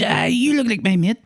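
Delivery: high mock stranger voice; imitating stranger. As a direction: pitch up as the odd man asking. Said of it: "he goes".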